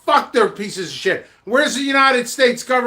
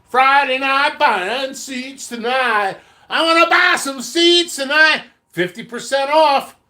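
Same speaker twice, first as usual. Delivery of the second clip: hyped sales pitch; 4 semitones higher than usual. Acted. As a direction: sell the seeds with hype. Hyped seed sale pitch.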